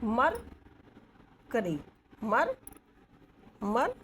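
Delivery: मर and करी said as separate, spaced syllables. Shown as a demonstration. Teacher splits the word into syllables for the class. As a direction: break मर करी into its beats. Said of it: "मर ऐसे बोलेंगे".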